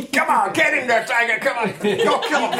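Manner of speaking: high, hammed-up coach cheering a fighter; mock coach pep talk. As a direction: pump up your fighter like a ringside coach.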